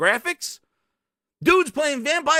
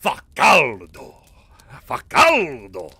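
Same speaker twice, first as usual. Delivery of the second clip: lower, slow, drawn-out "Facaldo"; Italian teacher; 10 semitones lower, 4 dB louder, much slower than usual. Retold or imitated. Mimicking his old Italian teacher fanning herself, low and slow. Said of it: "That's my Italian teacher used to do it".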